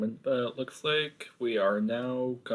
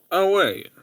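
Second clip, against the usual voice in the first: surprised; 10 semitones higher, 13 dB louder than usual. Acted. Sudden realisation.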